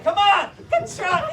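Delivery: high, loud urging into a yell; hammed-up martial-arts shout; karate goading. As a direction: egg him on, then roar. Instructor demonstrates karate yell.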